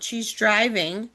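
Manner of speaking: ordinary talk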